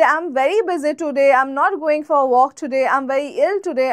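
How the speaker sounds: slow, even English rhythm demo. Shown as a demonstration. Teacher demonstrating English rhythm to students, slow and even. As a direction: stress each beat clearly, slowly. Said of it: "যেমন আমি যদি বলি যে".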